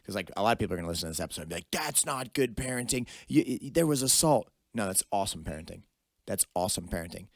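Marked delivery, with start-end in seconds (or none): 1.7-4.5 mock outraged listener. Listeners objecting to the parenting, mock outrage. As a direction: parody critics protesting in a put-on voice.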